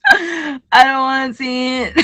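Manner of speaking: fake-sob it like a scared kid; mock crying. Joking about trench coat men in dreams.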